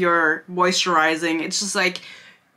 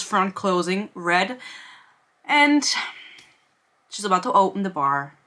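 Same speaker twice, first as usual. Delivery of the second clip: ordinary talk, nothing marked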